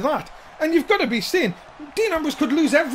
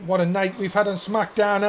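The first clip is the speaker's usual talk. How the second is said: ordinary delivery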